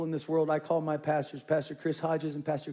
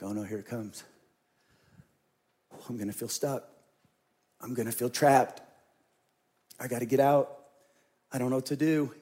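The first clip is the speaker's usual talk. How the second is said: hushed retold panic; 5 semitones lower than usual. Retold inner panic thoughts.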